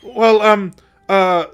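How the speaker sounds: loud, slow, ending in laughter; stall, then blurt it out emphatically; mock flustered insistence. Doctor cornered by Molly's question, flustered loud insistence.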